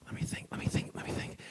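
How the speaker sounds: barely voiced whisper, thinking aloud; whispered to himself. Real, not acted. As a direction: whisper it to yourself while searching memory. Thinking aloud before answering.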